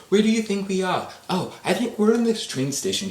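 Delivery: loud, high-pitched mock voice; high character voice. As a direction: put on a squeaky-high imitation. Acted exchange between Harry and Dumbledore.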